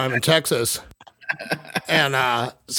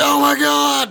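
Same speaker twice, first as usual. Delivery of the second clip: much higher and louder, a panicked shout; shocked outcry; 11 semitones higher, 4 dB louder, slower than usual. Retold or imitated. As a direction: blurt it out as the high kicks in. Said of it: "And I'm just like".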